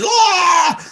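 high squealing "ah" of a starstruck fan; mock flustered shriek. Friend squealing at the sight of Charles Barkley, mock shriek. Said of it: "throwing a whole verklempt".

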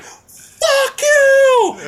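very high, drawn-out heckling yell; acted shout of outrage; furious heckle. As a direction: scream it at the stage. Acted yelling back at singer.

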